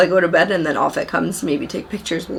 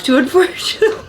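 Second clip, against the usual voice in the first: laughing; 9 semitones higher than usual. Real, not acted. Laughing about moving.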